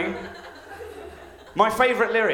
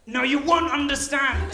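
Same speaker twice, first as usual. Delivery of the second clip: mock upset; 8 semitones higher than usual. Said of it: "he sounds really upset".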